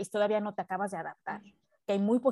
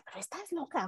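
higher, softer voice of a disbelieving mother; mom scolding; 7 semitones higher, 7 dB quieter than usual. Retold or imitated. Mother scolding her busy daughter, higher and softer. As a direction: play mom telling her she's crazy. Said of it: "Y mi mamá me decía".

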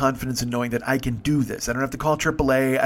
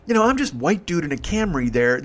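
ordinary delivery

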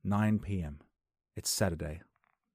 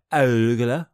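loud, high, incredulous "Arugula?"; mock disbelief; 4 semitones higher, 13 dB louder than usual. Mocking the American word, loud and incredulous. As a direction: throw up "Arugula?" as if it's absurd.

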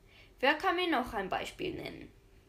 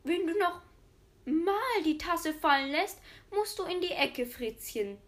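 higher, louder cross granny scolding; 5 semitones higher, 3 dB louder than usual. A narrator's reading. Grandma warns Fritzchen after the broken cup, crossly. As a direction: warn the boy sharply like an annoyed grandma. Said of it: "Sagt die Oma böse".

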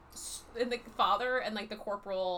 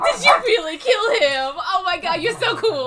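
much higher and louder, starstruck gushing; gushing excitement; 7 semitones higher, 14 dB louder than usual. Retold or imitated. The king gushes to the queen, high and loud with delight. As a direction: squeal it like a starstruck fan.

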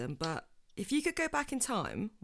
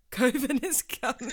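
laughing, louder repeat; 6 semitones higher, 7 dB louder than usual. Real, not acted. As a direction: say it through a laugh.

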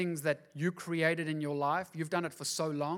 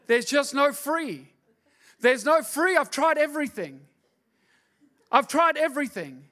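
loud, high, driving sermon; full preaching; 8 semitones higher, 7 dB louder than usual. Acted. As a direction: preach it hard to the congregation.

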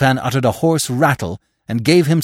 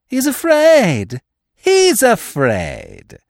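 loud, drawn-out triumphant cry; gloating cry; 11 semitones higher, 6 dB louder, much slower than usual. A narrator's reading. Widow sees fear in Lupin, gloating loudly. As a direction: crow it slowly, stretching each word.

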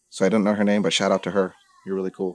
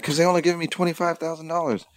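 high-pitched, slightly softer protest; retold griping, pitched well up; petty complaint; 9 semitones higher, 5 dB quieter than usual. Upset daughter complains to dad about payout, high griping. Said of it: "I know that sounds petty".

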